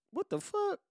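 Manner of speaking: very high, small retold shock; squeaky disbelief. Man sees the drugs pulled out, squeaky disbelief. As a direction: shoot the voice up into a squeak.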